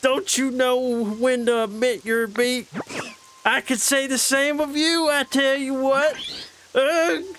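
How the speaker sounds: a cranky southern country feud voice. Acted. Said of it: "The, like, southern".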